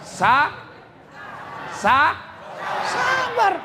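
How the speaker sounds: sabar stretched, ending high; drawn-out sabar. Shown as a demonstration. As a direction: stammer into sabar, then lift it. Drawn-out teaching of patience. (sa- sa- sabar diucapkan terputus lalu tinggi)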